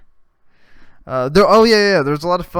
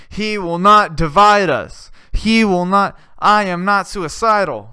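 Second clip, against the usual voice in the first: louder, slow defiant declaration; mock defiant shout; 3 semitones higher, 5 dB louder than usual. Jussie in court yelling, loud defiant declaration. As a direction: proclaim it like a protest chant. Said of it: "yelling that".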